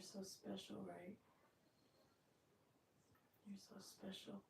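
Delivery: soft whisper to a pet. Real, not acted. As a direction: breathe it gently to the dog. Whispering to the dog.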